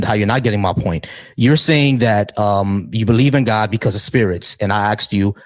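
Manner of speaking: usual speaking voice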